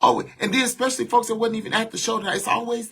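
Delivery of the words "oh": mocking advice-giver. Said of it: "I don't want people be like".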